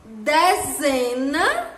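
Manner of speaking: "Dezena" said slowly, syllable by syllable; slow syllable demo. Shown as a demonstration. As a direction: stretch out "Dezena" for small children. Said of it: "Dezena começa com dé". ("Dezena" bem devagar, sílaba por sílaba)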